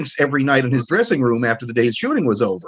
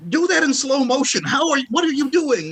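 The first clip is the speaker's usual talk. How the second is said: retold amazed plea; 12 semitones higher than usual. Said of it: "I would just go".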